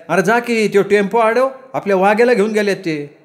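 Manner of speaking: high-pitched, pleading village mother; urgent mother. A narrator's reading. Mother urging her son to stop the tempo, urgent.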